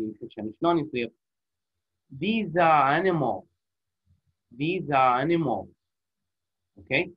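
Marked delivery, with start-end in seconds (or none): 2.1-5.7 slow, all three words run together; linked smoothly. Shown as a demonstration. Teacher showing students the joined phrase, slowly. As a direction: say it as one flowing unit. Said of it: "These are وكأنه كلمة واحدة".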